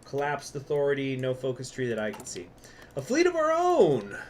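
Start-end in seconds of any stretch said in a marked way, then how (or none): 2.9-4.2 high and loud, a proud proclamation; dramatic headline. Acted. Player reading an event title: high, loud proclamation. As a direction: announce it like a triumphant herald.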